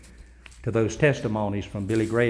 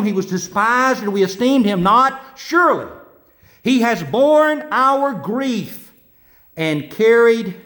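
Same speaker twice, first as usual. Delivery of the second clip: raised, higher preaching voice proclaiming; fervent preaching; 9 semitones higher, 5 dB louder than usual. Acted. Pastor proclaiming the gospel to the congregation, fervent preaching. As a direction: proclaim it to the whole church.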